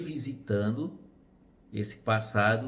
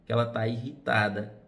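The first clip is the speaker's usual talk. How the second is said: ordinary delivery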